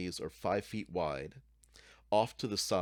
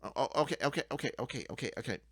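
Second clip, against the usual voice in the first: nervous goblin; 5 semitones higher, much faster than usual. Acted.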